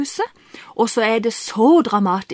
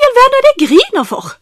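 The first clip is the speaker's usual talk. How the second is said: much higher and louder, rushed and baffled; bewildered outburst; 11 semitones higher, 6 dB louder, faster than usual. Retold or imitated. To himself in the car, bewildered and overwhelmed. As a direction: blurt it out, stunned by your own tears. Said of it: "For jeg tenkte".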